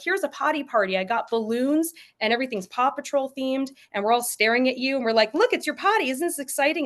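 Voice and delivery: high, gushing party voice; mock over-the-top cheerful parent; overexcited parent. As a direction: ham up the potty party excitement. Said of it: "if you're like".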